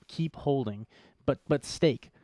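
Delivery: normal talking voice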